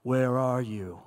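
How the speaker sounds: very low, measured God voice. Acted. God calling to Adam and Eve in hiding, deep voice. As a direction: drop way down into a booming-deep divine voice. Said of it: "you hear this voice".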